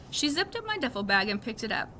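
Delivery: plain reading voice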